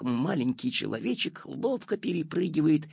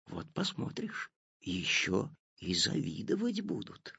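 soft breathy whisper; 3 semitones lower, 6 dB quieter than usual. A narrator's reading. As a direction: keep it hushed. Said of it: "также шёпотом ответил папа".

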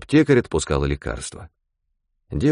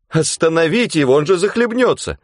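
alarmed cry; 9 semitones higher than usual. Acted. Girl on the shore cries out in alarm. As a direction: cry out in fear for him. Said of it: "вырвалось у девушки".

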